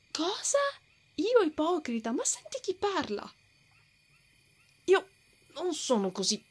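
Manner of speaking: high, incredulous retort; stung and scoffing; offended disbelief. Acted. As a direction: throw the accusation right back. Accused of hypocrisy, retorts.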